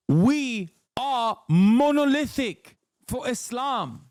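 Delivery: put-on high, very slow London podcaster accent; hammed-up London fundamentalist accent. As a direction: proclaim it slowly in a mock London accent.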